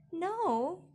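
high, loud yelled denial; yelling. A narrator's reading. Daughter packing in a hurry, yelling to her father. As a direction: yell it back, pitched right up. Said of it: "I yelled".

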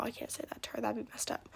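secretive whisper. Real, not acted. Girl worried her friend will hear, whispering. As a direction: drop to a whisper so no one hears.

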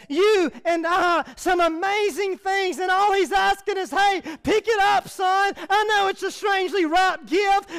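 high, loud preaching, slowing on key words; full-force preaching. Acted. Pastor preaching loudly to the congregation on God's gifts. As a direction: press the message home to the congregation.

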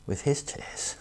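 near-whisper, soft and reverent; hushed, moved. Real, not acted. Preacher dwells on Jesus weeping with Mary, hushed. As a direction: drop to a whisper for the key phrase.

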